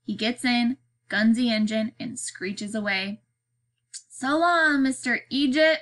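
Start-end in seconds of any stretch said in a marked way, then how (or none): 4.1-5.8 high, teasing farewell call; jeering call. A narrator's reading. Boy calls after the departing man, jeering. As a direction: sing out a mocking goodbye, pitched high. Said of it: "Beans calls, laughing".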